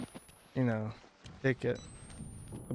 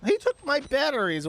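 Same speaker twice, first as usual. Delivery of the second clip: outraged; 12 semitones higher, 4 dB louder than usual. Real, not acted. Outraged at losing batteries.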